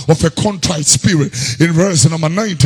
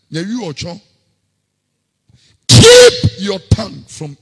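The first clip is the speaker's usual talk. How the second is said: fiery preaching; 3 semitones higher, 6 dB louder than usual. Acted. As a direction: exhort the congregation. Shouted, chanted exhortation.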